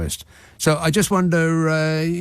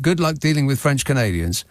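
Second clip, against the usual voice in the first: normal talking voice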